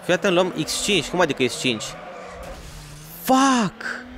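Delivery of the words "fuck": sharp high swear at the game; frustrated curse. Real, not acted. Gamer swearing at a missed combo, frustrated cry. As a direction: blurt it out in frustration.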